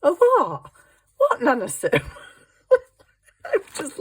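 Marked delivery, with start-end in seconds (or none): 0.0-1.9 1 confused grandson. Retold or imitated. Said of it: "he's just like".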